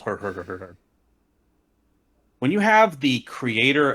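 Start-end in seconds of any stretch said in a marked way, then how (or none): 0.0-0.8 dopey "hur hur" mock laugh; mocking fake chuckle. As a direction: imitate a smug commenter's dumb chuckle. Mimicking a commenter's smug laugh.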